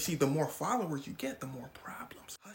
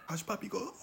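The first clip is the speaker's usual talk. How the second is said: ordinary talk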